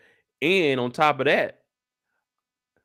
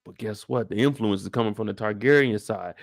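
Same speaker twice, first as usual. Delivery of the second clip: ordinary talk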